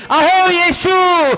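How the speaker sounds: high, loud, drawn-out plea; crying out. Retold or imitated. Preacher acts blind man begging Jesus for mercy, crying out. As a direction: shout the plea, stretching every name. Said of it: "त्याने ओरडून प्रभू येशू ख्रिस्ताकडे विनंती केली".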